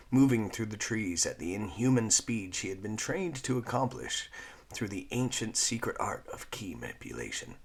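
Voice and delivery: ordinary delivery, nothing marked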